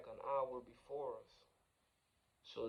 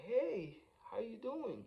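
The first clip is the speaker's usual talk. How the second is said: much higher-pitched imitated greeting; friendly hailing; 10 semitones higher, slower than usual. Homeless man waves at driver outside restaurant, friendly hailing. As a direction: imitate a stranger calling hello.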